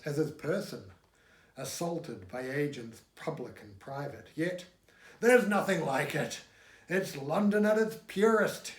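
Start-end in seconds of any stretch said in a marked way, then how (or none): 5.2-8.7 higher, louder eager cry; cries out. A narrator's reading. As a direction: exclaim it with delight. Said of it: "he cries".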